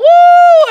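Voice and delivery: high, loud crowd-style whoop; cheering shout at full voice. Retold or imitated. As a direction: let out a long, high "woo" like youths after a song. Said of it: "siempre nosotros gritábamos".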